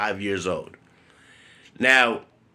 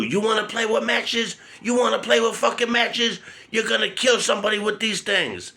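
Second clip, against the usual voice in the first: very high, strained mother's voice, scolding; furious mom; 11 semitones higher than usual. Retold or imitated. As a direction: imitate his mother shrieking over the matches. Mother scolding about matches.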